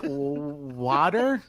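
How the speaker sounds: "water" as a careful, rising "wah-ter"; laboured "water". Retold or imitated. Customer showing the bartender, hesitant over-corrected "water". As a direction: stall on the w, then a questioning "WAH-ter" for water. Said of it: "I said it like that".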